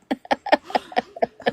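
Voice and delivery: breathy, voiceless giggle; soft airless laughter; silent laugh. Real, not acted. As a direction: laugh without voicing it. Laughing at the name mix-up.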